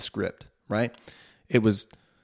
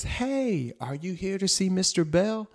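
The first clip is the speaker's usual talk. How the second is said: lady greeting; 9 semitones higher, slower than usual. Retold or imitated. Lady greets him in hallway.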